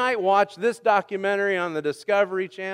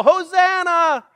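high, loud, drawn-out cry; yelled Hosanna; 14 semitones higher, 11 dB louder, slower than usual. Retold or imitated. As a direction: yell it like someone overboard. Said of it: "you would yell".

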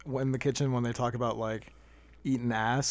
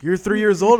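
loud, higher incredulous question; imitated disbelief; 7 semitones higher, 12 dB louder than usual. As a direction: act out a baffled coworker. Retold baffled coworker's question.

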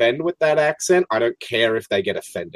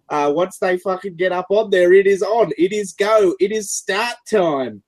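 raised, revved-up Australian bogan commentary; bogan announcer; 5 semitones higher, 3 dB louder than usual. Acted. Bogan announcer opening a King of the Hill match, hyped Australian accent. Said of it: "this announcer being hundred and ten percent bogan".

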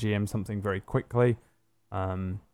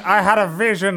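higher, louder mock insistence; hammed-up claim of a vision; mock insistent character; 9 semitones higher, 8 dB louder than usual. Game master imitates a player's character insisting on her vision, higher and louder. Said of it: "she's like".

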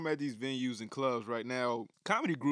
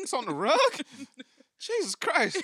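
disgusted exclamation; 11 semitones higher, 6 dB louder, slower than usual. Retold or imitated. Reacting to poop on rug.

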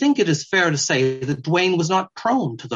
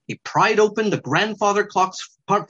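plain reading voice

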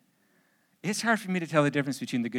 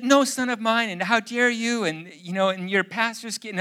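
higher, louder outraged mother; scolding mother; 7 semitones higher, 5 dB louder than usual. Retold or imitated. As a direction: scold like a horrified mom. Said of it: "then she goes".

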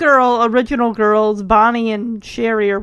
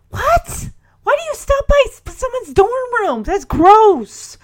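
incredulous outburst; 10 semitones higher, 4 dB louder than usual. Pretend. Imagining the dean's dorm visit.

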